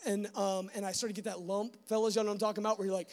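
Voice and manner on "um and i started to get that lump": ordinary delivery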